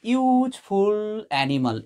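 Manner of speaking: ইউজফুল said slowly with a clear ইউ at the start; teacher modelling the ইউ in ইউজফুল for the class; slow pronunciation demo. Shown as a demonstration. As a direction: stretch out ইউজফুল animal so the ইউ sound is heard. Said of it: "ইউজফুল বলার সময় দেখো".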